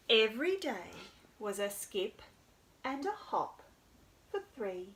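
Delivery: storytime narration; reading to children. A narrator's reading.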